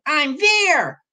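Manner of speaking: put-on high little-kid voice; squeaky storybook reply; tiny Toast. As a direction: pipe up eagerly in a tiny voice. Toast takes up the race.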